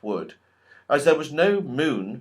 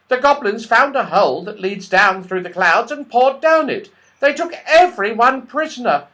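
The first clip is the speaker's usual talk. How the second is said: much higher, quick little squirrel voice; tiny squirrel voice; 11 semitones higher, 8 dB louder than usual. Acted. The red squirrel warns Joe the goblins took everyone, tiny and quick. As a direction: chatter like a tiny worried squirrel.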